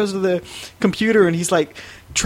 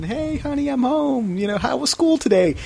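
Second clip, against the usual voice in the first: imitating a cheerful mom, pitched higher; 9 semitones higher than usual. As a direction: mimic mom breezing in, high and bright. Teller voices mom coming home.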